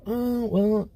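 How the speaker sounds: put-on coy girl voice. Girl's coy reply voiced.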